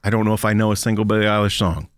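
normal talking voice

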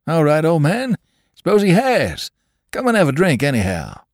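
high, easygoing drummer brushing it off; innocent and jocular. Acted. Drummer answers the young man, jocular and high. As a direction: cheerfully wave the danger away. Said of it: "innocent and jocular".

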